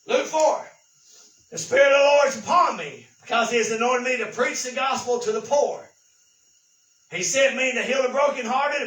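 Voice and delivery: booming scripture reading; preaching scripture. Acted. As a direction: proclaim it to the congregation.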